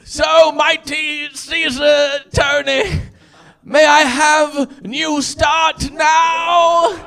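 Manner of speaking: slow, loud plea to a Roman emperor; hammed-up pleading student; pleading gladiator. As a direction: beg Caesar with grand drawn-out shouting.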